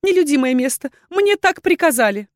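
high, loud, tearful excuse; pleading through sobs; sobbing. A narrator's reading. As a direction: whimper it out, voice climbing. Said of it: "всхлипнула женщина".

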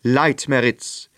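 hohe, laute Königsstimme, herrisch fordernd; gebieterischer König. Acted. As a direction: ein König, der keinen Widerspruch duldet. (König verlangt vom Zauberer Einlass, hoch und herrisch)

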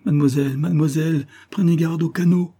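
high, pressing call; urgent warning. A narrator's reading. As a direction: call after her in alarm. Servant calls a warning after her.

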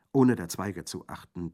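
usual speaking voice

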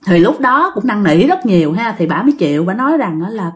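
ordinary delivery, nothing marked

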